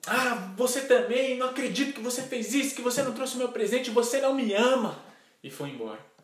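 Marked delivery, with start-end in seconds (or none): 0.1-5.0 put-on high, rapid child's tantrum; indignant girl, pitched high, rushing to a loud finish; angry daughter. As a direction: throw a hurt, acted tantrum at daddy. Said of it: "aquela filha ficou brava e falou".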